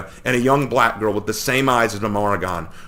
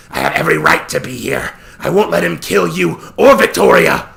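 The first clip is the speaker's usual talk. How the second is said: louder, higher determined voice; defiant yell; 7 semitones higher, 7 dB louder than usual. A narrator's reading. Warren insists on staying to protect them, defiant shout. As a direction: stand your ground out loud.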